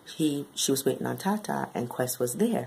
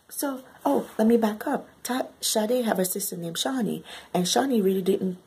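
normal talking voice

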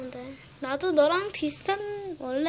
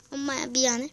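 normal talking voice

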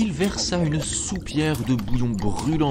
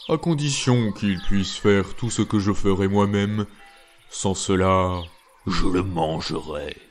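low, heavy giant's voice slowing down; 4 semitones lower than usual. Acted. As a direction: rumble it out like a lumbering giant. Giant voiced in narrated tale.

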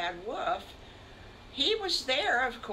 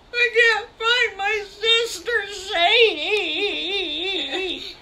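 high, loud, wailing sob through the words; crying child; 13 semitones higher, 13 dB louder, much slower than usual. Acted. Sally Skunk cries to Farmer Brown about her lost sister, high wailing sob. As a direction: blubber it out like a lost little one.

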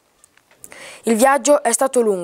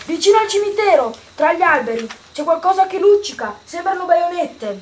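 put-on higher-pitched boy's voice; boy lookout reporting; 5 semitones higher than usual. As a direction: report a sighting from up high as a young lookout.

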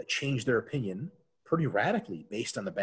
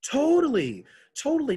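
emphatic agreement; 14 semitones higher, 7 dB louder than usual. Real, not acted. Enthusiastic agreement.

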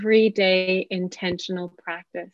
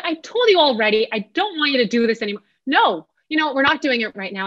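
put-on exasperated parent scolding children who won't get up; 5 semitones higher than usual. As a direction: nag the children like you've had enough. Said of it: "End scene".

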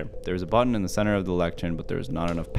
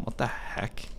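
ordinary talk, nothing marked

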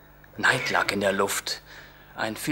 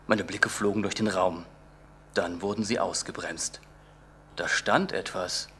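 plain reading voice

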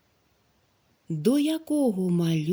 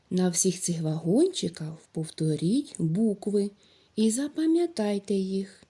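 ordinary talk, nothing marked

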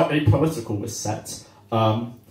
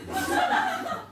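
mock solemn politician, slow and halting; hammed-up speechmaking; Liz Truss; 4 dB quieter, much slower than usual. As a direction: pause dramatically, then condemn it slowly. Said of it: "Dramatic pause".

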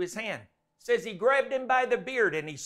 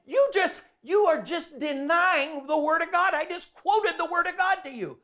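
heated retort; 8 semitones higher than usual. Retold or imitated. Retold argument rebuttal.

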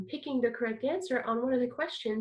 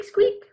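very high, loud squeak; a tiny mouse squeak; 11 semitones higher, 7 dB louder than usual. Shown as a demonstration. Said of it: "So you're gonna go squeak".

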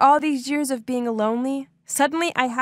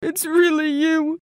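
tearful; 5 semitones higher than usual. Acted. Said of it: "he started crying and hugging me".

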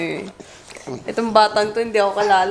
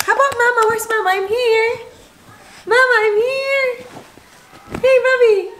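high pleading; 10 semitones higher than usual. Real, not acted. Mother begging her baby to notice her, high call.

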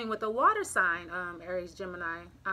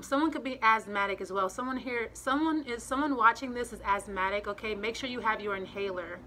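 ordinary delivery

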